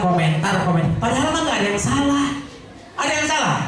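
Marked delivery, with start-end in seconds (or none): 3.0-3.6 laughing rhetorical question, pitched higher; playful higher-pitched challenge through laughter. Real, not acted. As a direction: toss it to the crowd with a laugh. Preacher challenges audience laughing.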